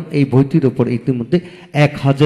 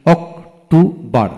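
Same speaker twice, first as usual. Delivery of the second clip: "October" slowly as অক, টো, বর; three separate beats in "October"; split into syllables; 3 semitones higher, much slower than usual. Shown as a demonstration. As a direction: sound out "October" piece by piece. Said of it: "তিনটি syllable থাকে".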